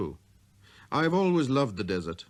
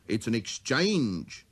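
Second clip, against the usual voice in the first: slow; much slower than usual. Acted. Switchman explains slowly.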